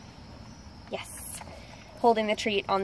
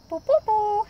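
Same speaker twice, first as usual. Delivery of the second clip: attention call; 13 semitones higher, 6 dB louder than usual. Real, not acted. Calling dog's attention.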